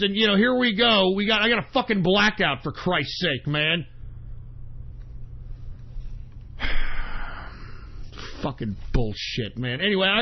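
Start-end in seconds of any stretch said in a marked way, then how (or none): 3.9-8.4 long, soft exhale; exasperated sigh. Real, not acted. Broadcaster about to take a break, long exasperated sigh. Fed up before leaving air. 8.4-9.8 low, soft and spent; disgusted grumble. Real, not acted. Broadcaster grumbling before a break, low disgusted mutter.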